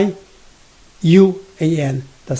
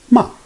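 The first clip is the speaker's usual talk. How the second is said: short "Ma" falling from high; fourth tone; 4 dB louder than usual. Shown as a demonstration. Teacher reading the four tones, fourth "Ma" falling. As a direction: drop "Ma" sharply. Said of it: "read those four tones all together".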